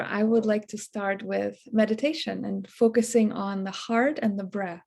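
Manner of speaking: ordinary talk, nothing marked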